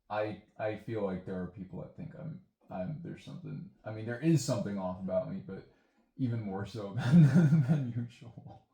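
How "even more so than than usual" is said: laughing. Real, not acted. Joking about being off.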